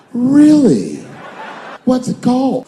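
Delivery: high, loud, thrilled questions; mock eager friend. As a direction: gush with excited interest. Excited friend's reaction.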